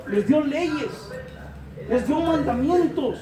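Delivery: loud, driving sermon with long held words; preaching at full force. Acted. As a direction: press each phrase on the congregation like a revival preacher. Pastor predica con fuerza. (predicando con fuerza, voz alta y enfática)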